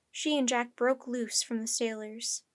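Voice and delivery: usual speaking voice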